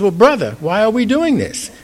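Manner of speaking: high-pitched but unforced curious question; friendly brotherly question. Acted. Saint Francis to Rumi while spinning along, friendly puzzled question. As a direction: ask as a companion, no judgment. Joins in, then asks gently.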